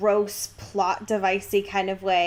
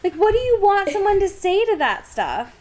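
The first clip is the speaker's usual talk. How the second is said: exasperated; 10 semitones higher, 5 dB louder than usual. Real, not acted. Cohost venting about Jacob's tactless line, exasperated high outburst. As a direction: voice jumps high and loud in disbelief.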